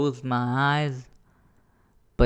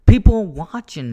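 alarmed outburst; 8 semitones higher, 13 dB louder than usual. Retold or imitated. Realising onlookers.

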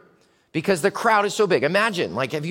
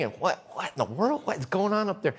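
normal talking voice

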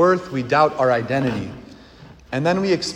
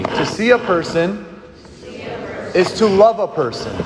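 higher, phrase by phrase with a long pause; leading a declaration; 4 semitones higher than usual. Shown as a demonstration. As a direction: lead the congregation to repeat after you. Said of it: "Say this with me".

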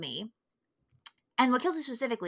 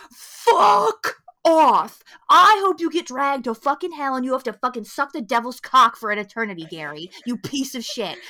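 angry; 7 dB louder than usual. Retold or imitated. Host curses out Gary over the film, angry. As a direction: let rip, then sink into contempt. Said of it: "Oh, bitch, I was angry".

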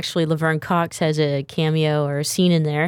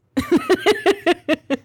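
high laugh. Real, not acted. Laughs at own joke.

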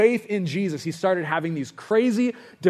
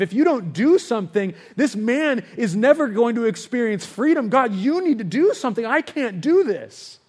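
insistent prayer building higher; desperate plea; 6 semitones higher, 3 dB louder than usual. Retold or imitated. Minister begging God to free a man, rising desperate plea. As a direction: plead with God, each demand more urgent.

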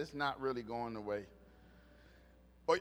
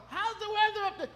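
very high, louder mocking question; mimicked mom; 5 dB louder than usual. Mom phoning her son about the weather, mimicked high. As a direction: pitch it way up, mimicking a chirpy caller.

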